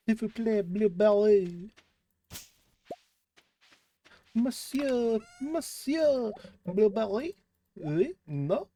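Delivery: put-on high, playful French accent. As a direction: ham up a French waiter, French accent. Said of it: "a little French accent".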